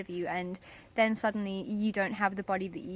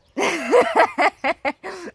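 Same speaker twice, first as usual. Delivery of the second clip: loud, high laughter; real, long laugh; 9 semitones higher, 12 dB louder than usual. Real, not acted. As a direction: burst out laughing at the joke. Laughing at the coconuts joke.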